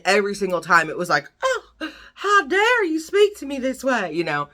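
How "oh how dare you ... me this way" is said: high, indignant Southern belle voice; mock Southern accent. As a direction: play an affronted character with a put-on Southern accent. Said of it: "I gave them Southern accents".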